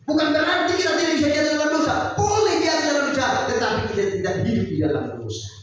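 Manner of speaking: loud, high, driving sermon cry; full-force preaching. Acted. Preacher rousing the congregation about sin, shouting high.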